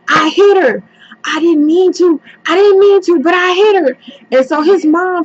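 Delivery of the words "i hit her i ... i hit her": put-on boyfriend confessing. Son confessing to his mom, higher and whining. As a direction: blurt it out to his mother. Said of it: "he goes".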